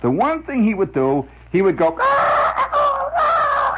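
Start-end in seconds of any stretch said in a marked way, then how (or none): 2.0-3.8 very high, loud imitation rooster crowing. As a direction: crow like grandpa doing his rooster bit. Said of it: "he would crow like a rooster".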